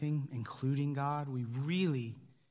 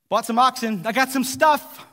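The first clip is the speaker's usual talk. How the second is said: louder, higher dismissive brush-off; hammed-up careless excuse, louder; mock excuse; 5 semitones higher, 8 dB louder than usual. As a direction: toss off the excuse like it's obvious. Mocking guests' excuses.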